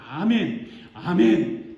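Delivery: loud, fervent imitated amens; mock-zealous repeated cry; passionate amen. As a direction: blurt amens like a stirred-up worshipper. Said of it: "아주 열정적으로 은혜 받고 막".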